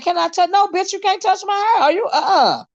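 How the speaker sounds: indignant sass. Retold or imitated. Retelling being asked to touch.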